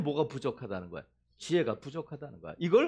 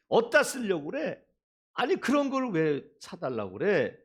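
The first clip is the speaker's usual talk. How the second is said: ordinary delivery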